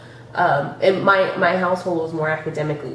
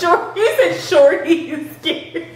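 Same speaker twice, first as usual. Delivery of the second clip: high, loud, laughing repeat; helpless laughter breaking up the words; laughing mockery; 11 semitones higher, 5 dB louder, much slower than usual. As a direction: crack up while mimicking the mistake. Laughing at partner's wrong names.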